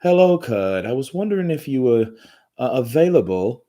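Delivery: light imitation of an Australian woman's accent; a hint of an Australian accent, friendly and inviting; Australian lady. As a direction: mimic the Australian coordinator asking politely in her Australian accent. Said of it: "that's what I think about when I hear it".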